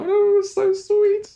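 overcome, about to cry; real emotion catching in the throat, slowed; near tears. Real, not acted. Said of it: "I'm gonna cry".

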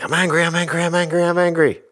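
high, loud mock-angry outburst; frustrated jumping-up-and-down anger. Dad venting at the stove: high, loud frustrated yell. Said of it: "so frustrated".

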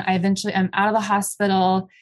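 normal talking voice

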